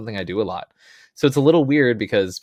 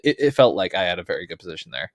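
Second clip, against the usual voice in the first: ordinary delivery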